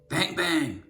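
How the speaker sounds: usual speaking voice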